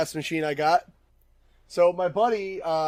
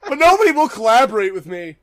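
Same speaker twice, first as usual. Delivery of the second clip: louder, higher whiny punchline repeat; Aaron impression; 5 semitones higher, 11 dB louder than usual. Host repeating his co-host impression as punchline, loud whine. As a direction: hammer the whine home, loud and high. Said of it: "I'm gonna do my best Aaron impression".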